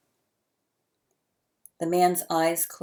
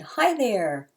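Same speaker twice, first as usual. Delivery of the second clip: put-on bright, higher baby squeal of joy; gleeful squeal; 5 semitones higher, 4 dB louder than usual. A narrator's reading. Baby squealing hello at a stranger, gleeful. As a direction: squeal it out with glee. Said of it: "Eric squealed with glee and said".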